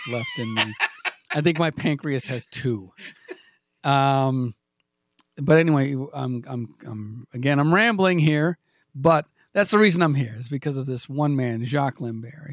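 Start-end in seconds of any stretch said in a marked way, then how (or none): none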